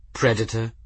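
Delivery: ordinary delivery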